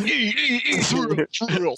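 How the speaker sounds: booming monster truck announcer. Pretend. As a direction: hype it like a truck rally ad. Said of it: "You gotta say that in Monster Truck voice".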